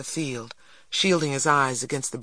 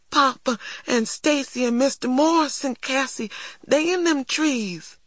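put-on distressed little boy; 10 semitones higher than usual. A narrator's reading. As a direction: realize it high, close to tears. Small boy near tears, fears for father.

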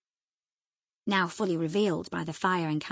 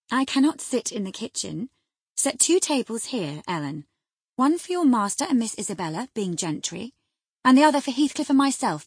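droll, raised-voice teasing; half-angry, half-amused; 5 semitones higher, 8 dB louder than usual. A narrator's reading. Wife mocks husband's fastidiousness, half angry, half amused. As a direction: needle him with mock deference, pitched up and louder. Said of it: "half angry, half laughing at his fastidiousness".

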